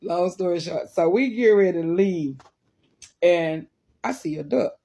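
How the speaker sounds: usual speaking voice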